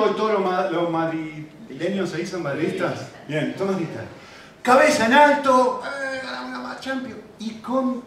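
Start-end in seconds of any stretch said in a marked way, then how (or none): 4.6-8.0 high, loud triumphant fan; mock Madrid fan gloating; proud fan. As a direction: crow like a winning supporter. Mimics proud Madrid fans.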